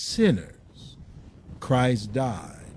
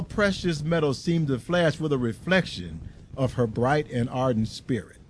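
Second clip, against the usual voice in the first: ordinary delivery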